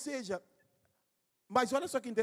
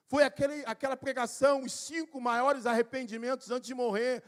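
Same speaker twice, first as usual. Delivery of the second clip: usual speaking voice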